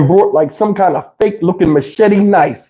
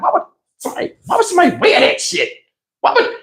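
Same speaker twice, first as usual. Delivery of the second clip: put-on baffled kid; 8 semitones higher, faster than usual. Young self baffled by snake name.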